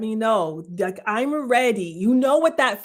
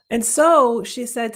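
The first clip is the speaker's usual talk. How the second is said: ordinary talk, nothing marked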